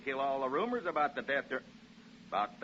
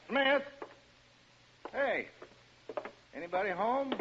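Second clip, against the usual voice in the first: calling out; 9 semitones higher than usual. Acted. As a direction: shout into the house. Calling upstairs.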